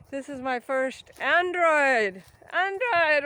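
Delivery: Android said slow and loud; stress on Android. Real, not acted. Showing her new phone, Android stressed. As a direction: lean on Android, drawn out. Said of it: "Not iPhone".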